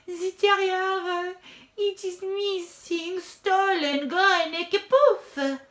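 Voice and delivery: put-on high, slow French-accented lament; tearful diva. A narrator's reading. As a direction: wail it out piece by piece. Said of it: "tears welling out of her beautiful eyes".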